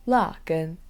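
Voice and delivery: la gen said slowly as Mandarin; slow, clear Chinese la gen; Chinese pronunciation. Shown as a demonstration. Host teaching the word for horseradish, Chinese pronunciation of la gen. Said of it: "In Chinese".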